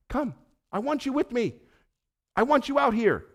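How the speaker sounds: high-pitched, heartfelt invitation; earnest calling out. Retold or imitated. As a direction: lift the voice and beckon them out. Jesus calling out from chaos.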